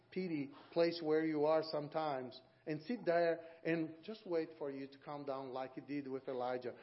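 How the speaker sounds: usual speaking voice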